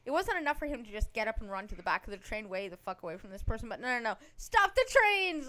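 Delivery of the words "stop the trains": high, loud, drawn-out cry; mock alarm yell. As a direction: yell it out like an overreacting passenger. Acting out the panicked train passenger.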